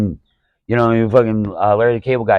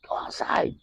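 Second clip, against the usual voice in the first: breathy whispered warning under the breath; whispered aside; 12 dB quieter than usual. Retold or imitated. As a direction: hiss it out as a low whispered threat. Retold telling noisy audience off.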